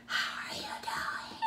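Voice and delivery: slow, unvoiced loud whisper; loud stage whisper. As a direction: whisper it too loudly, slowly. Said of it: "It was the loudest whisper".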